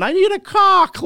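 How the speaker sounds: high, loud mock scream. Cohost screaming on the radio, mock scream. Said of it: "Robin's screaming".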